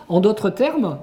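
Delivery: usual speaking voice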